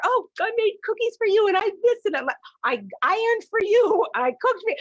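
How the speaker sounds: high, bright doting voice; gushing sweetheart. Retold or imitated. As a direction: coo like an eager newlywed. Gushing lover offering favours.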